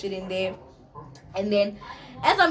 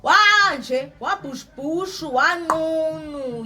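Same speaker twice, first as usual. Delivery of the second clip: lightly voiced boyfriend, pitched higher; Harry's put-on voice; 7 semitones higher, 6 dB louder, much slower than usual. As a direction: mimic him higher, stretching the last word slowly. Voicing Harry's words in scene.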